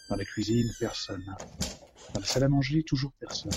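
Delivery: hushed. Acted. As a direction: drop right down as if creeping.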